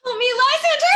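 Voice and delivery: panicked cry for Lysander; terrified scream. Acted. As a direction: wake from a nightmare shrieking his name. Hermia wakes from nightmare screaming.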